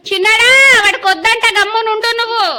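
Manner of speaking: high, loud scolding shout; mother shouting the younger son into silence; angry yell. Real, not acted. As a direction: tell him to shut up, hard.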